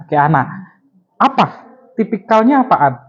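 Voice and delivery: ordinary talk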